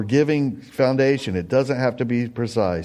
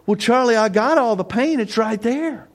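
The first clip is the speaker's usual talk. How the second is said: high, bright mock of a proud paint owner, much higher; smug homeowner; 11 semitones higher than usual. Pastor voicing himself to friend Charlie in garage, mock smug. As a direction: ham up a man showing off his unused paint. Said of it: "take Charlie in my garage and go".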